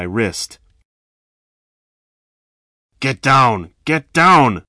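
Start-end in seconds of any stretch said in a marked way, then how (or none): 3.0-4.6 loud order growing louder. A narrator's reading. Thin robber forcing victim down, loud order. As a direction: command it harder the second time. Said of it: "the thin one ordered".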